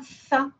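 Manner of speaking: "za" with a th fricative, tongue between teeth; fricative th sound. Shown as a demonstration. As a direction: demonstrate "za" as an interdental fricative. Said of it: "es una fricativa".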